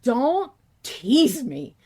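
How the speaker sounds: ordinary talk, nothing marked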